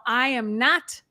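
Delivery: normal talking voice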